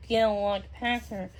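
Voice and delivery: plain reading voice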